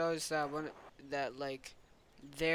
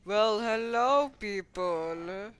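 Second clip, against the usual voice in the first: loud, raised, drawn-out greeting; villain's grand greeting; 6 semitones higher, 9 dB louder, much slower than usual. Pretend. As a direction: make a showy entrance, stretching it out. Intruder makes his entrance in play.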